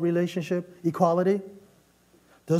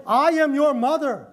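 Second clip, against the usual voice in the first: higher, louder pulling-rank line; condescending mother; 6 semitones higher, 4 dB louder than usual. Retold or imitated. Mom reminding her grown child who's boss, condescending. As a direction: lay down the law as mom. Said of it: "that kind of language is condescending".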